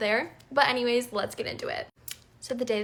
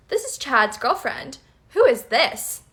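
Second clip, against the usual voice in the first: firm demand; 2 semitones higher, 8 dB louder, slower than usual. Retold or imitated. Demanding on the phone.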